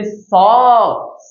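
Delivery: sharp s as in English S, vowel held; dental s. Shown as a demonstration. Teacher showing pupils the dental letter, dental s. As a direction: say স with a hissing s, not sh. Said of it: "তুমি যদি দাও দন্ত স".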